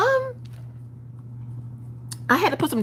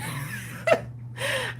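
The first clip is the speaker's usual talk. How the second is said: giggle; 7 dB quieter than usual. Real, not acted. Laughing at herself.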